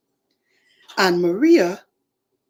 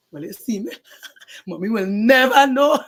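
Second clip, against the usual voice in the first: gleeful laugh; 3 semitones higher, 6 dB louder than usual. Acted. Child gleeful at hiding.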